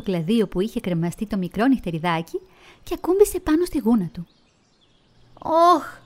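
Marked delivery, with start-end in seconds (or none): 5.4-6.0 sudden high, loud yelp of pain; shouted ouch. Acted. Little bat yelps at the hot sunbeam, high and loud. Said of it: "φώναξε".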